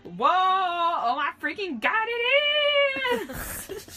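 very high, loud, drawn-out squeal; excited shriek. A narrator's reading. Friend agreeing the venue is huge, excited shriek. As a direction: shriek it, climbing to the end.

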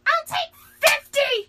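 slow, very high full scream. A narrator's reading. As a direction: shriek it like a frantic fangirl.

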